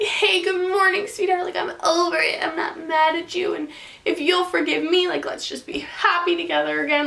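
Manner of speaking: high, mock-sweet boyfriend voice; mocking his imagined tender wake-up.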